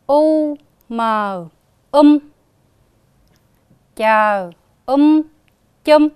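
careful model for pupils to hear; spelling out. Shown as a demonstration. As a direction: sound out u, mờ, um, then chờ, um, chung. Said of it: "nghe cô đọc lại". (cô đánh vần mẫu cho học sinh nghe)

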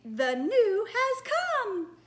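high, loud, very slow princess; ringing princess, stretching every word. Pretend. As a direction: proclaim it as a grand princess. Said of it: "very best princess voice".

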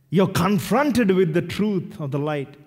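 ordinary talk, nothing marked

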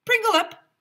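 very high, loud megaphone bark; bullhorn shout. Acted. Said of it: "shouted through his bullhorn".

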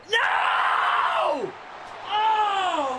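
very high, drawn-out "no" scream; anguished wail. Real, not acted. Gamer seeing a terrible start, wailing. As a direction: wail in dismay, voice shooting way up. Play goes badly wrong.

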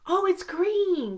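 high gasp of discovery; mock surprise. Teacher to kids, pretending to discover the color green. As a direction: act delighted to find it.

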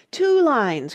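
loud, high-pitched call; crying out. A narrator's reading. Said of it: "cried the Mock Turtle".

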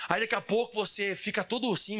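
ordinary delivery

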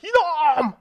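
sharp furious yell; angry shout. Acted. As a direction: bark it at your son. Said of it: "더 크게 화를 내며".

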